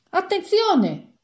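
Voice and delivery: very high, loud and drawn-out warning; sudden alarm shout. A narrator's reading. Lawyer warning everyone the bus is leaving, sudden loud shout. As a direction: cry out as the bus starts moving. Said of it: "gridò ad un tratto l'avvocato".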